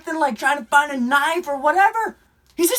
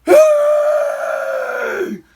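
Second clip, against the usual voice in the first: huge high growling shout; Kraken yell; 11 semitones higher, 5 dB louder than usual. Retold or imitated. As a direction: roar it like a monster. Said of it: "Sounding like the stinking Kraken".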